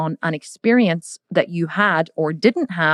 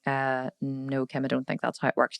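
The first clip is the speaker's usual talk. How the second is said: doubtful reply; 4 semitones lower, 10 dB quieter than usual. Retold or imitated. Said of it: "And you'd be like".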